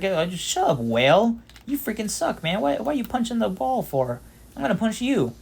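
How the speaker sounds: high, hammed-up irritated yelling; mock annoyed Luffy. Luffy scolding the whale, mock annoyed and high.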